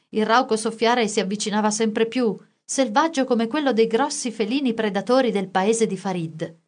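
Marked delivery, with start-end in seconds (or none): none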